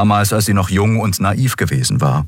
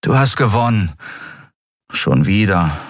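resigned Miko. A narrator's reading. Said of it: "seufzte er resigniert".

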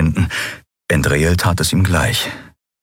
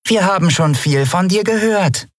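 meaningful; 12 semitones higher than usual. Acted. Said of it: "sagte die Yadi bedeutungsvoll".

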